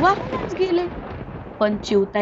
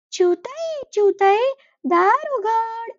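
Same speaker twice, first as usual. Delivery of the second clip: higher pleading crow voice calling out; crow calling at the door; 9 semitones higher, slower than usual. Acted. Cold crow begs the sparrow to open her door, pleading call.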